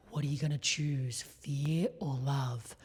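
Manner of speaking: eerie, breath-heavy mock chant; hushed-voiced, intense cult recruiter; cult leader. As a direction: imitate a creepy cult leader repeating a mantra. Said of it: "I sound like a cult leader".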